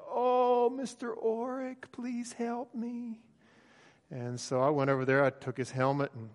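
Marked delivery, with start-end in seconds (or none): 0.1-3.2 pleading for help. Retold or imitated. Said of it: "I could hear him over there saying".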